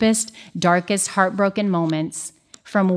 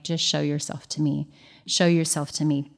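softer, a quiet plea to the Lord; hushed prayer; 4 semitones lower, 6 dB quieter than usual. Retold or imitated. Speaker voicing her morning prayer, softly and pleading. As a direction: ask gently, as if praying alone.

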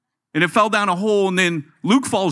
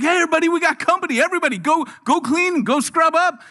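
much higher, mock fifties mom summoning the family; mom calling out; 9 semitones higher than usual. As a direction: call the house to tidy up for company, hammed-up.

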